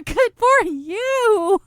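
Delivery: high, loud, drawn-out whoop; exuberant cheer. Real, not acted. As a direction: cheer them on, stretched out and high. Exuberant teasing cheer to listeners.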